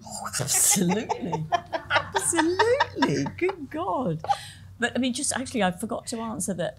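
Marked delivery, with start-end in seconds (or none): 0.1-4.2 high, laughing, gushing absolutely; giggly yes. Real, not acted. Asked about fancying Colin Firth, laughing and gushing high. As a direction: laugh and gush over him.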